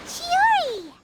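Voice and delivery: high hail with a falling tail; calling out. Acted. Paimon spots Chiori at the party, calling out. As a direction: call over to a friend by name.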